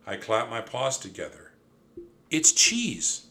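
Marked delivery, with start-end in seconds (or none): 2.3-3.3 high, loud cry of discovery; delighted high-pitched outburst; gleeful shriek. A narrator's reading. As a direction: shriek it with sudden glee. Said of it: "I shrieked with glee".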